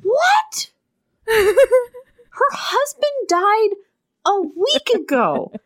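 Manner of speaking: schrilles, fassungsloses Aufheulen; outraged squeal, half crying; outraged disbelief. Real, not acted. Outraged at the book.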